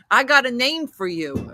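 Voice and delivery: mock a brash New Jersey radio caller; imitating Jersey caller. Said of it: "That's how he said it".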